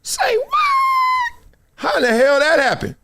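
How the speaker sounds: high, long shouted cry; shocked shout. Real, not acted. Reacting to the award list, a high shocked shout. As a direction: yell it in disbelief.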